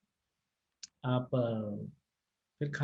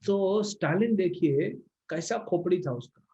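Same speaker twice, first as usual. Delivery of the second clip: ordinary talk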